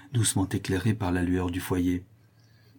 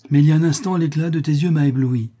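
raised, louder voice; impassioned protest; 6 semitones higher, 7 dB louder than usual. A narrator's reading. Notary telling Cécilie he is dazzled, impassioned. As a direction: gush with dazzled passion. Suitor protests his passion.

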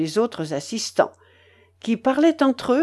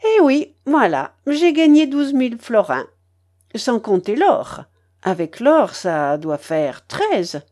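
put-on triumphant old grandmother; 3 semitones higher, 4 dB louder than usual. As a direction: boast of the winnings with relish. (vieille babouchka triomphante)

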